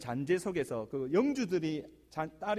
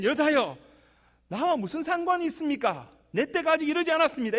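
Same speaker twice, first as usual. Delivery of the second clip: much higher and louder proclamation of the words; declaimed quote of Jesus; 10 semitones higher, 7 dB louder than usual. Retold or imitated. Pastor proclaiming Jesus' words to the church, loudly. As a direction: ring out the Lord's reply. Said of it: "여튼".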